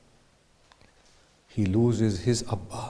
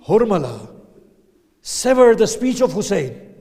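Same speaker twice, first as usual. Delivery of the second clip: high, loud command to kill; shouted order; 9 semitones higher, 11 dB louder than usual. Acted. Umar ibn Sa'd orders Hurmala, high loud shout. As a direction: bark the order across the field. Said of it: "says to Hurmala".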